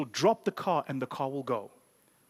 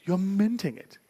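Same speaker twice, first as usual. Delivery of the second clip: playful voice; 2 semitones higher, slower than usual. Acted. Repeated in a put-on voice.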